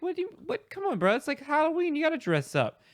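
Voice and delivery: high-pitched retold disbelief; incredulous protest. Student argues with classmates over Halloween costumes, incredulous.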